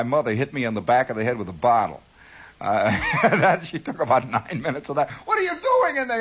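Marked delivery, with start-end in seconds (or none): 5.3-6.2 high-pitched mock motherly scolding. Mother to noisy kid in his room, high scolding. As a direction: call out sharply in a high mother's voice.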